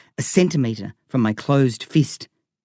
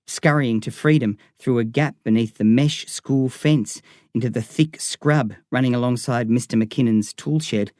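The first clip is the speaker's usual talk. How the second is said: ordinary delivery, nothing marked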